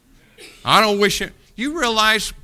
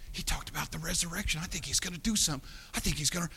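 hushed hopeful families; 11 dB quieter, much faster than usual. Retold or imitated. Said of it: "can you imagine the families".

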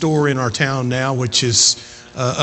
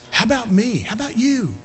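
higher, driving pulpit voice; impassioned preaching; 7 semitones higher than usual. Acted. Preacher urging church to keep witnessing, impassioned. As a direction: press it home with rising passion.

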